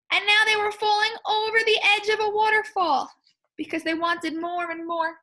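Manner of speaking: excited. Real, not acted. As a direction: rush it with excitement. Explaining excitedly.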